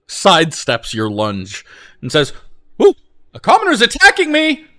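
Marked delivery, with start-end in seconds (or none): 2.8-4.7 shrill mocking noble. GM voices a mocking noble NPC.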